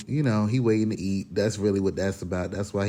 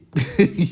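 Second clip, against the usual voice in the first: short high laugh; 8 semitones higher, 5 dB louder than usual. Real, not acted. Laughs at her own joke.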